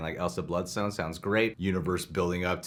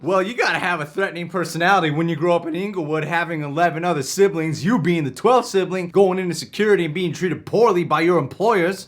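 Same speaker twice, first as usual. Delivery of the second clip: higher, bossy security guard; 10 semitones higher, 8 dB louder than usual. Acted. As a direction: wave the line through like a bored guard. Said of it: "I'll play Alfred".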